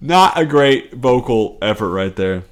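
usual speaking voice

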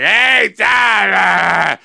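put-on loud, high holler of an old man; mock old-man phone shout. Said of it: "he was like an old man".

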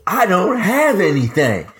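wife's mock plea. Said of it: "she drew a big circle on the table".

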